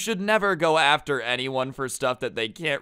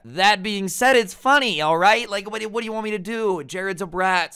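excited outburst; 8 semitones higher, 6 dB louder than usual. Real, not acted. Speaker bursts out defending roast.

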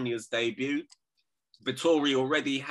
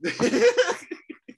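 loud, high laugh; burst of laughter; 7 dB louder than usual. Real, not acted. Laughing at co-host's insult of the fighter, helpless laugh. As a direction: crack up loudly.

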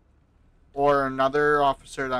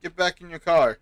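loud firm police order; stern command; 5 semitones higher, 7 dB louder than usual. Acted. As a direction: bark it like a cop. Officer orders man back to car.

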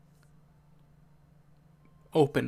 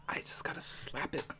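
whispering; 13 dB quieter than usual. Real, not acted. Whispering before slapping the button.

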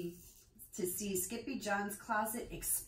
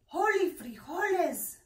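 high, loud exclamation; loud cry; 10 semitones higher, 8 dB louder than usual. A narrator's reading. Scipito thrusts his sword at the bandito, crying out loud. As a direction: cry out in brave panic. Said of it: "cried Scipito".

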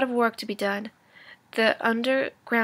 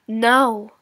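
put-on a sudden loud "No" from a boy; boy's loud refusal; shout; 9 dB louder than usual. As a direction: cry out the "No" in protest. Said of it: "shouted little Willie".

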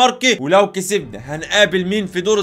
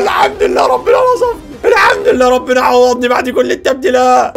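overjoyed crying shout; 2 semitones higher than usual. Real, not acted. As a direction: cry out thanks, overwhelmed. Overjoyed after save.

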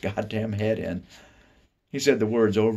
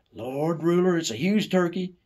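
high, louder amazed voice; amazed exclaim; 12 semitones higher, 5 dB louder than usual. Pretend. Imagined grown-up marvels at Ruler's turkey, high. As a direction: marvel at the size.

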